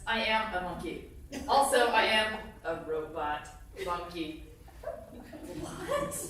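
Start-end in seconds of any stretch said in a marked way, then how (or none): 5.6-6.2 a high, startled What?; shocked squeak. Acted. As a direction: squeal it in disbelief. Reader shocked by the text.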